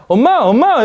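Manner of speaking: high, loud call for mom; high-pitched calling child. Acted. Child bursts in calling mom, high and loud. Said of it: "음조는 높고 낮음을 보는 건데".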